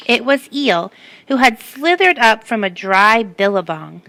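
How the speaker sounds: ordinary delivery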